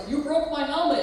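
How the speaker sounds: high, loud retold accusation; retold yell. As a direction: shout the blame back as he did. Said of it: "he's like".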